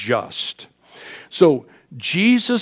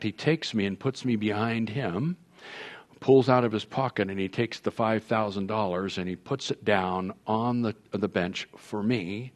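ordinary delivery

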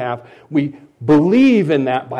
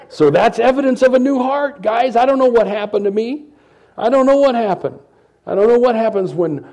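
excited; 10 semitones higher, 5 dB louder than usual. Real, not acted. Excited high preaching about tears.

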